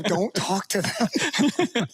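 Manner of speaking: laughing. Real, not acted. Joking warning while laughing.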